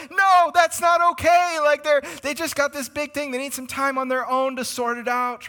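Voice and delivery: high, loud protest, stretched at first; mock-panicked pleading, easing off in volume; freaked out. Children objecting to Mom visiting the sick, freaked out. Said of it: "we were all freaked out".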